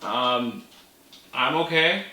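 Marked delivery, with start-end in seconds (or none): none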